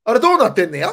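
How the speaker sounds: high, loud prying question; a mother demanding an answer; mock nosy mom; imitating mom. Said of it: "って、こう、問いかけられたら".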